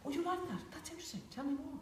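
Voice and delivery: calm, curious. Retold or imitated. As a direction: stay centred and curious, not needy. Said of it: "you stay kind of centred".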